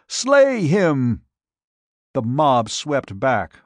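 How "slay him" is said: high, loud shrieked order, strained; queen screaming after her escaping prisoner; shrill command again. A narrator's reading. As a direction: shriek it once more, desperate. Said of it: "Zaina shrilled".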